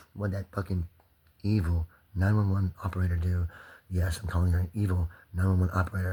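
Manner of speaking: normal talking voice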